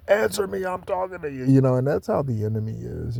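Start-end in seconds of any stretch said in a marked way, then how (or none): 0.1-1.5 high, insistent demand as the wife; pitched-up, pushy "answer me" order; imitating a nagging demand, higher; demanding quote. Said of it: "and then she says".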